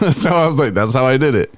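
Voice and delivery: amused high laughing quote; laughing through it. Retold or imitated. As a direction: laugh as you deliver the comeback. Laughing retelling his answer.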